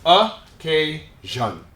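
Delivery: occasion very slowly in three beats, uh-KAY-zhun; syllable by syllable. Shown as a demonstration. Teacher models the word for English learners, slow syllable-by-syllable occasion. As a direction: break occasion into slow separate syllables, schwa first. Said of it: "We say occasion".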